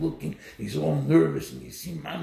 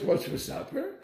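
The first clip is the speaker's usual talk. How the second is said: casual; 12 semitones higher than usual. Retold or imitated. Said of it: "and then we ask".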